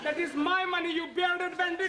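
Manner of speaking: angry. Acted. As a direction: defend your money angrily. Quarrel over money.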